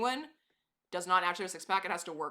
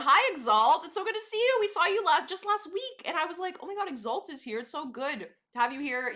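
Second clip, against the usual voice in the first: high, louder gushing greeting; excited hello; 10 semitones higher, 7 dB louder than usual. Real, not acted. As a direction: gush over a returning friend. Greeting a returning viewer.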